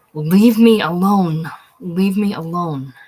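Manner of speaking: a loud, slow scream that eases on the repeat. Acted. Frightened girl screams at the man grabbing her arm, loud and slow. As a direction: scream it out, terrified. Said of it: "The girl screaming out".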